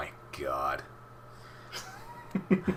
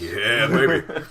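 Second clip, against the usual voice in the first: mock swagger; 5 semitones higher, 7 dB louder, slower than usual. Playful gloating about packing light.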